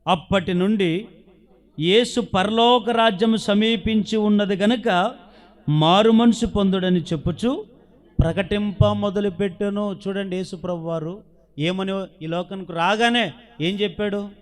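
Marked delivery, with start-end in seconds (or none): 0.1-10.0 slow, loud scripture reading; preaching scripture. Acted. Preacher reading the verse aloud to the congregation, slow and loud. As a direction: proclaim the verse slowly to the church.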